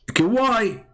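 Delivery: mocking high-pitched taunt; mock squeak. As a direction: squeal the question like a cartoon devil.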